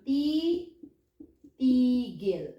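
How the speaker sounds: long "ti", a pause, then tigil; slow teaching demo of ti, tigil; syllable by syllable. Shown as a demonstration. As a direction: separate ti from tigil and stretch it. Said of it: "we repeat this".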